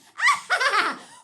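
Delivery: loud, very high evil cackle; witch cackle. Pretend. Comedian cackling as the witch. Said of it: "Wizard of Oz".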